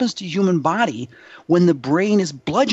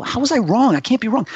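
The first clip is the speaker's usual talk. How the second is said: indignant disbelief; 7 semitones higher than usual. Retold or imitated. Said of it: "I go".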